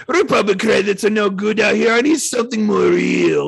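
slightly wrong copied voice; Watto impression. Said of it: "Dude, that was great".

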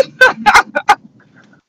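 a big, high burst of laughter; real loud laugh. Real, not acted. Big laugh at dead phone.

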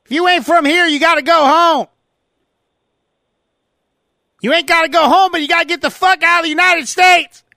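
loud mock Southern accent yelling. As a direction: rant like a cartoon bigot. Mock racist Southerner rant.

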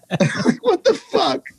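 high, slow what-the-fuck through laughter; laughing disbelief. Real, not acted. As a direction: laugh at the absurdity while asking.

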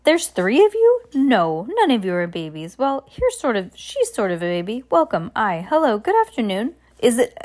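a cheerful, scatterbrained captain contradicting himself; scattered cheerful captain. Acted. Captain greeting the orphans.

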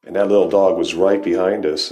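ordinary delivery

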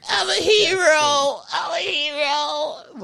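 very high, drawn-out wailing falsetto; hammed-up high cry, fading at the end; mock tearful triumph. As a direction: imitate a kid crying out his glory. Voicing tackled player's triumph.

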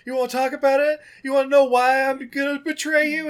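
loud, high sung taunt; Judas. Retold or imitated. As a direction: pick a fight, high and loud. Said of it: "Judas straight up is like".

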